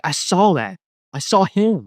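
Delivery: rising, high-pitched amazement; astonished realization. Retold or imitated. Reading scripture and recognising his vision, astonished.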